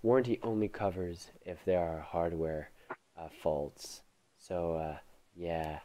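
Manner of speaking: deadpan salesman, voice dropped low; a hint of an indifferent shop clerk; imitating clerk. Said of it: "And he's like".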